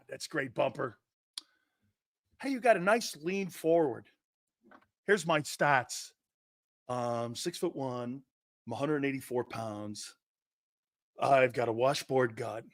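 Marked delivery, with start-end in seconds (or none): 5.0-12.6 a hushed, lowered bragging jock; mock low player voice. Host mocking a player bragging to scouts, low hushed voice.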